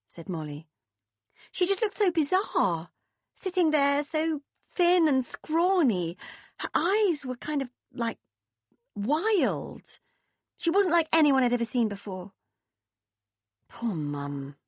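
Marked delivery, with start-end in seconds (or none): 1.5-12.3 put-on high, wide-eyed young girl; young daughter, unsettled and higher; teenage daughter voice. 13.7-14.5 soft sad sigh of sympathy. Acted. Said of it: "Meg sighed again".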